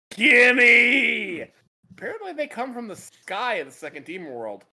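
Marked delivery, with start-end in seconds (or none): 0.1-1.5 loud, high grabby cry; greedy shout. Retold or imitated. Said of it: "Bulma's like".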